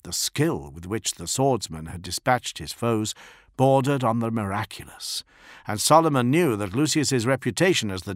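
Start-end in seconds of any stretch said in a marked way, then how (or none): none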